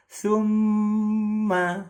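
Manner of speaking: summa with mm held three beats, slow and high; held doubled m. Shown as a demonstration. Teacher demonstrating mim tasdid, mm held. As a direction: hum the mm of summa long. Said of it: "Jadi bacanya".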